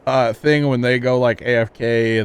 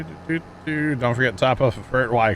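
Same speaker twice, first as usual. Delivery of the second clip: normal talking voice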